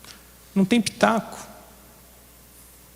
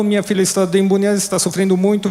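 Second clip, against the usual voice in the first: pleading cry; 6 semitones higher, faster than usual. Retold or imitated. The Canaanite mother cries out to Jesus, fast and pleading. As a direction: plead quickly, as if calling after someone. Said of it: "ela continuou gritando".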